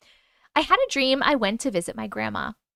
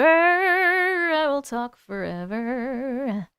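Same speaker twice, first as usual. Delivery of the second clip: drawn out slowly; much slower than usual. Shown as a demonstration. Promising the chat to talk forever, drawn out slowly. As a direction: stretch every word out very slowly.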